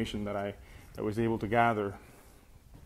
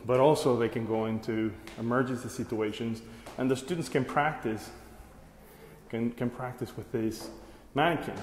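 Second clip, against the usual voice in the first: ordinary delivery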